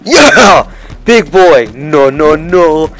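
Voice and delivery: loud, high gloating yell; triumphant shout. Real, not acted. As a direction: roar over the big block. Yells after blocking a goal.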